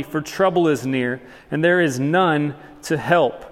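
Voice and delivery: ordinary delivery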